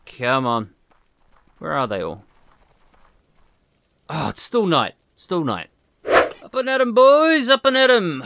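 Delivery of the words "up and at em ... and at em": loud, high rallying call to the sleeping workers; rousing wake-up call. Acted. Colonist calling his diggers awake, loud and high. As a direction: holler them out of bed.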